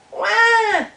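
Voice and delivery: very high, loud cartoon bawl; mock wail. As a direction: bawl like an upset little beaver. Beaver wails across the pond.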